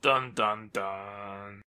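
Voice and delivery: low, drawn-out "dun dun dun"; ominous movie-sting sound, played for fun; dramatic suspense sting. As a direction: intone a mock cliffhanger sting, fading at the end. Mock dramatic suspense sting after reveal.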